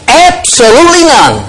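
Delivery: ABSOLUTELY NONE high and sustained, falling at the end; emphatic stress. Shown as a demonstration. Preacher turning it around another way, emphatic ABSOLUTELY NONE. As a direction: ring out ABSOLUTELY and drop on NONE. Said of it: "really trying to stress".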